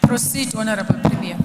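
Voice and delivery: calling out firmly across the chamber; loud call. Real, not acted. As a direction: announce it loud and formal. Chair calls premier to speak.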